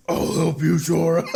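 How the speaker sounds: garbled duck-voice attempt, loud and high; Donald. Shown as a demonstration. Co-host attempts the requested line, garbled duck impression. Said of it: "give me your best Donald impression".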